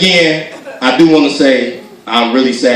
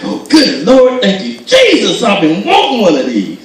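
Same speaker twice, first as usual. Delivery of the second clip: imitating excited mom; 7 semitones higher than usual. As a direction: play a mother overjoyed at her present. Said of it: "she get all excited".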